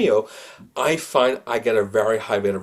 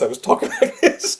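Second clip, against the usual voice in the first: laughing through the words, pitched higher; 8 semitones higher than usual. Shown as a demonstration. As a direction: breaks into laughter mid-demo. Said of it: "The other eighty percent".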